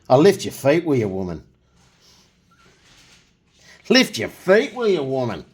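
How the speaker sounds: high, loud call to the wife; joking holler at the wife; mock calling out. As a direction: call out in jest.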